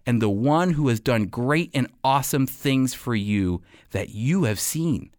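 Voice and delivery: usual speaking voice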